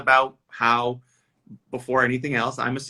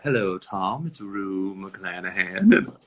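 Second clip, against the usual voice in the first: Rue McClanahan voice; 4 semitones lower, slower than usual. Retold or imitated. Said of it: "the first voicemail I got from her".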